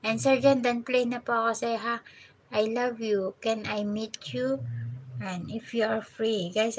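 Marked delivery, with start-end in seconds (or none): none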